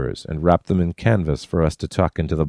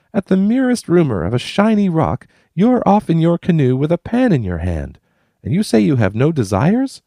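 much higher, louder teasing woman; Kate teasing; 12 semitones higher, 4 dB louder than usual. A narrator's reading. Kate ribbing Tom about his prospecting. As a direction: poke fun at Tom's claim.

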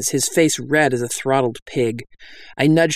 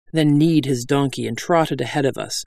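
usual speaking voice

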